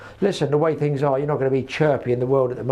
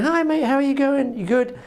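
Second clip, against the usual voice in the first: much higher, bright greeting to a dog; high cooing to a dog; 11 semitones higher than usual. Retold or imitated. Chatting happily to a strange dog, high cooing voice. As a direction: coo at the dog like a happy owner. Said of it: "and you're going".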